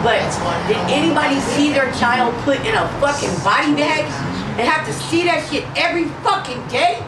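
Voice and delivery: raw, furious, rising to a shout; real anger breaking through; angry outburst. Real, not acted. Grieving mother's outburst in meeting.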